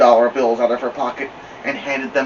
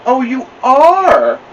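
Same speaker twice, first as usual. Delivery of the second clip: slow, much higher, louder teasing voice; amused librarian; 13 semitones higher, 10 dB louder, much slower than usual. Acted. Librarian teasing a naughty little girl, slow and high. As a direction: draw it out like a librarian playing along.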